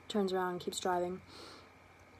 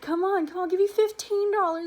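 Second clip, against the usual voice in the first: loud, high, urgent bribe; dad bribing his crying daughter; pushy bargaining dad; 11 semitones higher, 9 dB louder than usual. Retold or imitated. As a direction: raise the offer at full volume. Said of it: "my dad's like".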